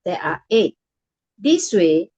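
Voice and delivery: usual speaking voice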